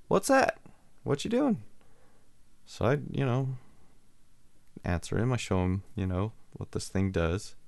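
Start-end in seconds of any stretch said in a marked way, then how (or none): 0.1-1.6 put-on high, curious little-kid voice. Neighbor kid sneaking up on the man pulling hedges, high little-kid voice. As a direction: pipe up from behind like a small boy. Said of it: "I hear this little voice behind me".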